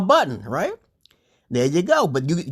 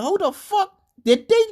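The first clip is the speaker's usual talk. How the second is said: much higher, loud, ending slow and drawn out; much higher indignant exclamation, dragging the last words; retold outburst; 3 dB louder, slower than usual. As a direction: shout it like it's obvious, then spell it out slowly. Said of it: "I just said".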